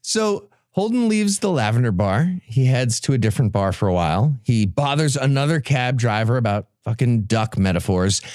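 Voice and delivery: ordinary delivery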